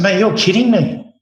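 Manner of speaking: can't believe he's pulling you out mid-rush; incredulous protest. Retold or imitated. Retold panic during busy service.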